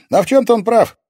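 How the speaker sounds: put-on high, loud teenage boy; lively, high-pitched lad; boyish character voice. A narrator's reading. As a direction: explain brightly in a ringing boy's voice. Cheerful boy keeps explaining himself.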